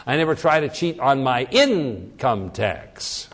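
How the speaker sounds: slow delivery, leaning hard on "income"; emphatic "income", falling away on "tax"; stress on INCOME. Shown as a demonstration. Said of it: "If I emphasize income".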